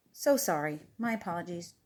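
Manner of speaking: soft, low apology; meek apology. Acted. Animals leaving after Mister Duck's outburst, meek and soft. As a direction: shrink back and apologise quietly.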